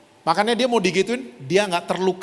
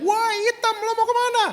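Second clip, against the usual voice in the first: much higher, harder jeering mock shout; the second kid outdoes the first, shrieking the taunt; louder imitated bully yell. A second schoolmate yelling the insult, higher mock shriek. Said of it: "lebih kenceng teriaknya".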